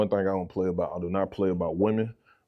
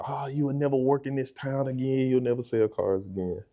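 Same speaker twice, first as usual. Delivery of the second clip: higher-pitched mock outburst, settling down as it ends; boss's threat; 6 semitones higher than usual. Boss firing him from the dealership, mock threat.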